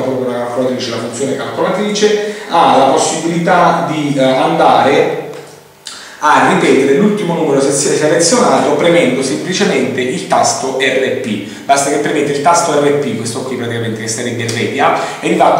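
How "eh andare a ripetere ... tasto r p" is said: usual speaking voice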